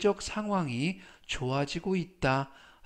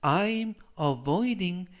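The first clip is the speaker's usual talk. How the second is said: slow demo; much slower than usual. Shown as a demonstration. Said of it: "활용 문장 보시겠습니다".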